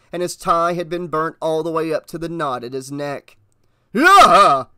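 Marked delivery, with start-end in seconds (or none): 3.9-4.7 very loud, high cry of shock; sudden wordless yell of alarm; startled yelp. A narrator's reading. Officer jumping at the smoking man behind him, startled yelp. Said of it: "Martell exclaimed, for lack of anything better".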